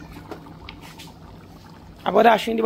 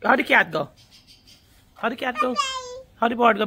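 usual speaking voice

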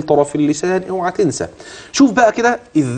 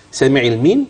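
normal talking voice

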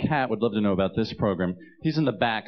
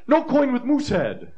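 high, louder broken English of a new cab driver; a cab driver refusing the coin in a high, louder voice; cab driver accent; 14 semitones higher, 5 dB louder than usual. Retold or imitated. Cab driver turns away Canadian coins, high and louder. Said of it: "They look at me and go".

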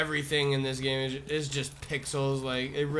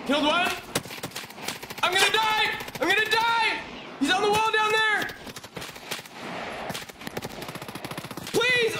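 panicked shouting. Real, not acted. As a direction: scream it like you're about to die. About to die in firefight.

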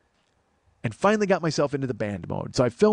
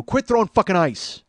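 higher, louder, slow threat; stern warning; 6 semitones higher, 7 dB louder, slower than usual. Retold or imitated. Bouncer telling the ice thrower to stop, slow loud threat. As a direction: lay down the law, slowly.

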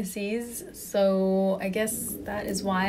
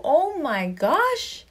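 drawn-out swoon; 6 semitones higher, much slower than usual. Real, not acted. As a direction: stretch it out like you're melting. Swooning over uniforms.

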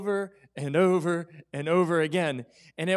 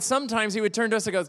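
normal talking voice

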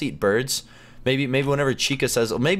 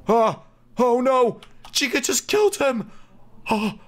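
startled cry as Chica kills a man; shocked; 13 semitones higher than usual. Real, not acted. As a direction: yelp it out in shock. Sees Chica kill someone.

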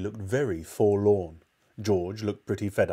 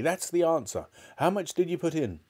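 higher-pitched, eager voice; excited cry; 7 semitones higher than usual. A narrator's reading. Mister Cranky questioning George about the ingredient, eagerly excited. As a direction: press on eagerly after a discovery. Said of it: "cried Mister Cranky".

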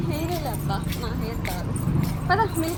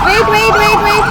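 calling out; 6 semitones higher, 11 dB louder, faster than usual. Real, not acted. Calling after a running child.

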